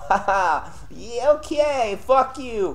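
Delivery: high, drawn-out mock-cheerful voice; forced lightheartedness. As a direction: act funny while still fuming. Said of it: "try to be more lighthearted and act funny now".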